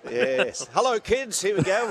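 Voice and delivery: high, hammed-up children's host; put-on high showman voice; mock kids' entertainer. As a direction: pretend to greet a crowd of kids.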